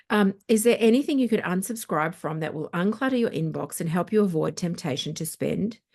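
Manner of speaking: ordinary talk, nothing marked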